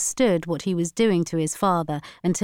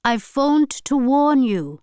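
slow, higher grandmother voice; solemn warning; 8 semitones higher, 5 dB louder than usual. A narrator's reading. Grandmother phoning granddaughter to warn her, solemn. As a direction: deliver a slow, weighty warning.

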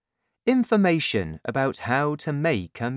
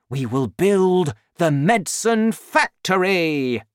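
higher, emphatic scheming, building to a big finish; too excited to listen; 5 semitones higher, 5 dB louder than usual. A narrator's reading. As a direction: lay out the plan with mounting relish. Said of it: "Mister Cranky was too excited".

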